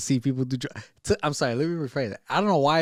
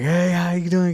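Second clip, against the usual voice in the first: lightly put-on higher voice; mock-friendly hello, pitched up; imitated eager greeting; 6 semitones higher, 4 dB louder than usual. Clarence greeting the guys in prison, eager greeting.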